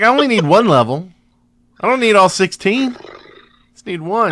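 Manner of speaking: high, fast, smug gloat; gloating fake sympathy. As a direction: gloat sweetly about needing one. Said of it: "I'm like".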